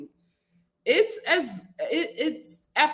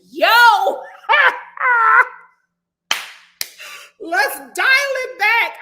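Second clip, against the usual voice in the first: high, loud, laughing cry of disbelief; shocked viewer begging the family to stop, laughing loud; exasperated laughing yell; 11 semitones higher, 9 dB louder, slower than usual. Retold or imitated. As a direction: laugh out a plea to tone it down. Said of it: "I was like".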